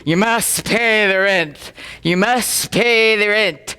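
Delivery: slow, menacing melodrama villain. Acted. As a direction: draw out each word like a villain. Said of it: "And the persecutor".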